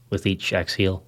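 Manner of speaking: ordinary delivery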